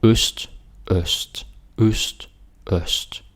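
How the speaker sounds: "øst" said four times with rounded ø; Danish ø drill. Shown as a demonstration. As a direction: repeat "øst" slowly for learners. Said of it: "And so".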